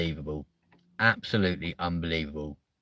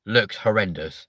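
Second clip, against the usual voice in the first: ordinary talk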